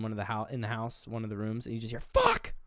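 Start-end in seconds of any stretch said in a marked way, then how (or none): 2.0-2.5 high furious yelled curses; angry yelling. Retold or imitated. Retelling the father cursing alone in his room, yelling. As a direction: scream the curses in rage. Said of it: "He's yelling".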